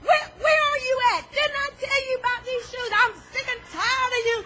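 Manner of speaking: mock angry yelling. Yelling at family over shoes.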